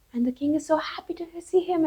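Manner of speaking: ordinary delivery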